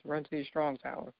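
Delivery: ordinary talk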